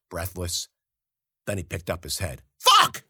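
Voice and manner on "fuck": sudden high, loud yelp of fright; startled yell. Acted. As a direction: jump and shout in shock. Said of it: "I yelled".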